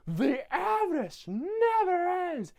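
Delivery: high, slow theatrical howl; mock exasperated wail. Grinch lamenting greed to the Whos, high wail. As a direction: soar up and drag it out. Grinch rant, soaring repeat.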